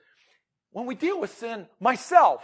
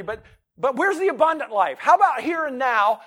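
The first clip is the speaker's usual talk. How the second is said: louder, higher challenge; loud preaching; 8 semitones higher, 15 dB louder than usual. Acted. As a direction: fire the question at the room. Preacher's climactic question.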